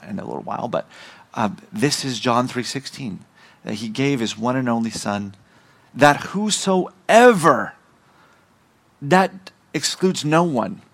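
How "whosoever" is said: "whosoever" slow, high and loud; stress on "whosoever". Shown as a demonstration. Preacher showing the verse excludes no one, "whosoever" emphatic. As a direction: punch out "whosoever", stretching it wide.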